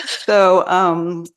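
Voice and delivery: laughing through the words, high and loud. Real, not acted. Guest joining the host's laugh, laughing. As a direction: start again while still laughing. Laughing after "an accident" joke.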